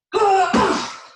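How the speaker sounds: loud, high, forceful exhaled cries; explosive venting of anger; angry outburst. Shown as a demonstration. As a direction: grunt hard with each pillow blow. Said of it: "put the anger into these pillows".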